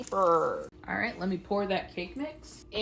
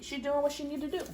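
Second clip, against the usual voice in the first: ordinary talk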